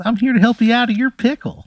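squeaky high cartoon pickle calling out; little pickle shouting up from the plate; tiny pickle voice. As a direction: call up from below in a tiny squeaky voice. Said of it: "he goes".

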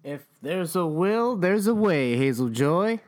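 loud, high sing-song reply; excited sing-song. Acted. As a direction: belt it out playfully. Playful loud rhyming reply.

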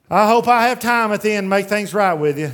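loud, high mock of a careless excuse; mocking careless sinner. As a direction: mimic the careless excuse loudly. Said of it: "And then we say".

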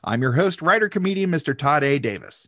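upbeat, projected announcer read; podcast intro. Acted. Host opens Star Trek podcast for fans new and old, announcer style. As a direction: welcome the fans like a show announcer.

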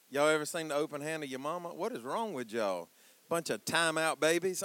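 usual speaking voice

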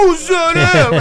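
loud, high, mock Italian mangling; hammed-up Italian accent. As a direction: overdo the Italian accent at full volume. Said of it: "when someone's Italian".

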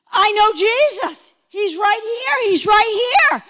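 high, loud, urgent joy; excited announcement. Retold or imitated. New convert telling lifelong Christians, excited announcement. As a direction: announce it like astonishing news. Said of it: "I looked and I said".

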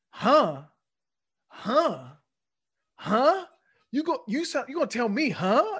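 high, loud, mounting mock "Huh?"; animated mock badgering. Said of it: "He's doing all this, so animated".

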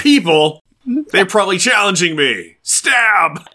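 hammed-up indignant cry, building to a yelled last word; Namor impression. Mocking Namor's version of being challenged, hammed-up outrage. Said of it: "this is Namor's version of it".

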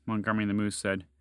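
ordinary delivery

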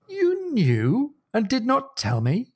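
high, slow, incredulous question; shocked disbelief. Acted. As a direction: draw it out as a betrayed friend's astonished question. [name] acted confronting Stephen's secrecy.

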